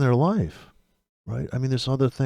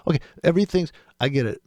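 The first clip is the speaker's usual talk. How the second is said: ordinary talk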